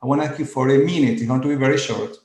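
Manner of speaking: normal talking voice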